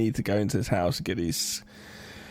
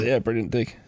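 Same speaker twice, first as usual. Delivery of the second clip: ordinary talk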